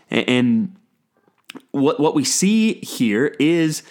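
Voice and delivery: ordinary talk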